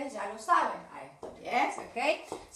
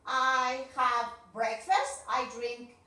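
louder, deliberate teacher talk; modelling a routine; 4 dB louder, slower than usual. Shown as a demonstration. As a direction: present your day clearly to young pupils. (la maestra presenta su rutina como modelo)

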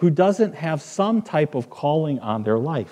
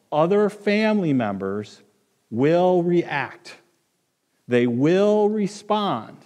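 preaching build; 5 semitones higher, slower than usual. Acted.

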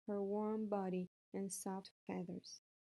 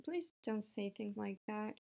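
plain reading voice

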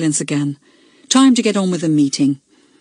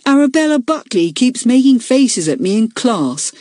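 higher, aggrieved schoolboy complaint; complaining boy; 6 semitones higher than usual. A narrator's reading. Daniel complaining to the meeting about Arabella, higher aggrieved boy voice. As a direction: tell tales with a whiny edge. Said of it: "said Daniel Carter".

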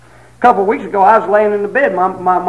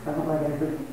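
usual speaking voice

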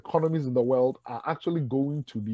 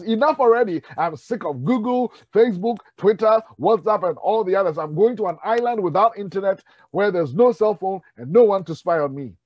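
raised, fed-up outburst at the machine; exasperated caller; 5 semitones higher, 5 dB louder than usual. Retold or imitated. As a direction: let it boil over, louder and higher.

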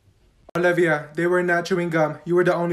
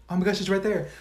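usual speaking voice

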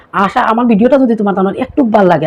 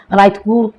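ordinary talk, nothing marked

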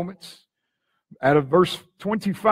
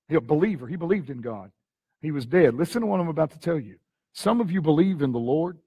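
ordinary talk

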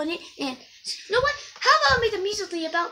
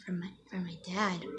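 whispering; 11 semitones lower, 12 dB quieter than usual. Acted. Answers insult in whisper.

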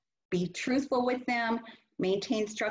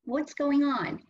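put-on higher, caring questions to a crying child; gentle concerned asking; 6 semitones higher than usual. Shown as a demonstration. Teacher showing parents how to ask a crying child, caring and higher. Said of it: "If the child is having a tantrum or crying".

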